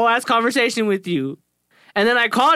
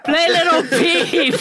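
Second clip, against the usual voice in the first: slow mock command; 6 semitones higher, much slower than usual. Mock command to smart speaker.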